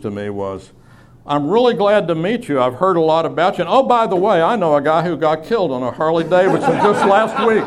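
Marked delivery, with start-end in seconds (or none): 1.3-7.6 higher-pitched, gushing imitation of a new acquaintance; eager well-meaning acquaintance. New acquaintance greets the motorcycle-riding preacher, gushing. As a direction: greet heartily, then blurt out the news. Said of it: "a common reaction I would get".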